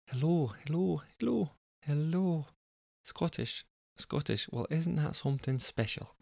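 quietly retune a Scottish accent; Scottish accent warm-up. Shown as a demonstration. Narrator finding Jessica's voice again, soft Scottish accent. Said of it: "getting into my zone of a Scottish voice".

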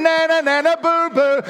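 high, loud sing-song na na boo boo; mock taunting. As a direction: tease the enemy in playground chant. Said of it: "I'm looking over him going".

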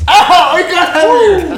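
loud, high shriek with laughter; pained yelp. Real, not acted. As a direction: yelp from a real slap. Real slap lands.

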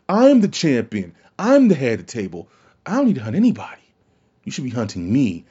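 acting as champion. Voicing Roman's reasoning.

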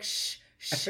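whispered Portuguese-style shh sounds. Retold or imitated. Teasing his Portuguese-sounding French with whispered shh sounds. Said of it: "he speaks like Portuguese".

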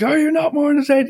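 high, hammed-up arena challenge; theatrical gladiator cry; Maximus in Gladiator. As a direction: call it out like a mock film hero. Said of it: "Maximus in Gladiator".

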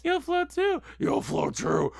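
high, loud eerie clown taunt; creepy clown voice. Retold or imitated. Mocking the clown line to a friend, hammed-up clown voice. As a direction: ham up the monster clown's lure.

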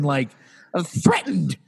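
ordinary delivery

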